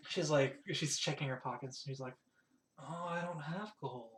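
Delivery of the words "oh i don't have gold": deflated realization. Acted. Character finds pockets empty.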